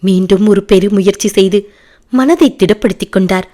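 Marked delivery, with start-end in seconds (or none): none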